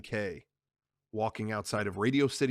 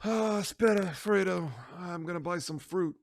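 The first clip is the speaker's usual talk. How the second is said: put-on higher old Italian father; a lightly voiced old Don; Don's voice; 6 semitones higher than usual. The Don telling Fredo he's buying fruit, lightly voiced.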